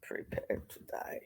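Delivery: breathy whisper of a duel threat; whispered movie line. Acted. Quoting the movie to the class in a whisper. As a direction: hiss the threat under the breath.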